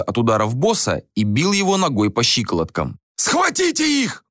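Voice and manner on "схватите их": high, drawn-out order; acted cry to seize them; shouted command. A narrator's reading. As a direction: order your men in urgently. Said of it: "приказал Рюйс".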